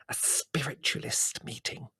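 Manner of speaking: slow, secretive whisper; hissed whisper. A narrator's reading. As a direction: hiss it like a scandalous secret. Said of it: "she hissed".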